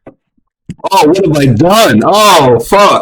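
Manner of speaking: high, panicky mock realisation; panicked self-doubt. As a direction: gasp at your own wasted year. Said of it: "start to question".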